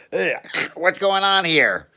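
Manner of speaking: high, loud put-on cop voice; a suspicious cop barking a question; gruff cop. Cop questioning a wet man in bushes, loud high voice.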